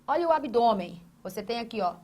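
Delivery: ordinary delivery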